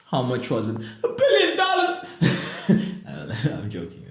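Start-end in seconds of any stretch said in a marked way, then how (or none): 0.8-2.0 squeaky joke voice. Pretend. Mock guess of the amount. 2.1-3.4 breathy laugh. Real, not acted. Laughs at own joke.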